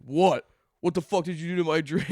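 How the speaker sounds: imitated irate confrontation, loud opening; mock outrage, demanding an answer; angry demand. Friend confronts them over his spiked Gatorade, mock-angry demand.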